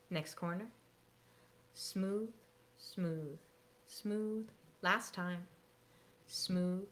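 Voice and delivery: quiet, slow counting along; soft guided instruction. Shown as a demonstration. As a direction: guide them gently, hushed. Leads a quiet scarf routine.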